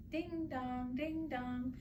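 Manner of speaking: high sing-song ding dong; bell chime. A narrator's reading. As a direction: chime it like distant bells. Bell sound voiced high.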